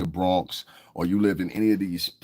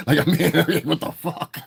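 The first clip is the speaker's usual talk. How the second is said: laughing; 10 semitones higher, 4 dB louder than usual. Real, not acted. Laughing at a chat comment.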